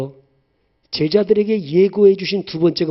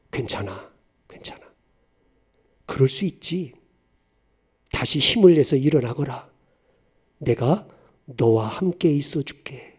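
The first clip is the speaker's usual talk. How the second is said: tender comfort; 4 semitones lower than usual. Acted. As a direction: soothe a hurting child. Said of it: "나에게 실망했다고 말하지 않으세요".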